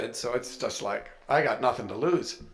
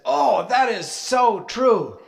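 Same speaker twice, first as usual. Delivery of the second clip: high, slow, loud swooning awe; awed wife; 9 semitones higher, 7 dB louder, slower than usual. Retold or imitated. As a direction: melt into it like a revelation. Kim voiced, awed agreement.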